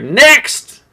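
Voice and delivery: loud high call of next. Acted. Shopkeeper calling the next customer forward, loud. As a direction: shout for the next one in line. GM calls next student again.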